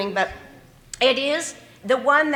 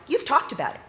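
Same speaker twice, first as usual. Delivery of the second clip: ordinary talk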